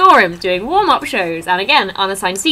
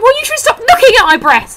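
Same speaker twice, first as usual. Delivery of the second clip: much higher, louder snappy comeback; high retort; 8 dB louder than usual. Retold or imitated. Fan telling off the comedian, high and loud. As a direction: fire the comeback back high. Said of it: "And I was like".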